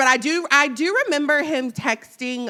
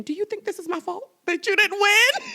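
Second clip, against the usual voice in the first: high-pitched, anxious retold question; mock worried question; 10 semitones higher than usual. Host nervously asks Kamala about her own question, mock worried. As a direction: voice climbing into a high mock plea.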